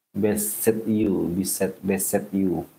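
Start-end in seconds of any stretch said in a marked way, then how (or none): none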